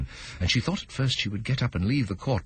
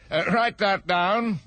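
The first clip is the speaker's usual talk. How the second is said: king voice; 11 semitones higher, 3 dB louder, slower than usual. Acted.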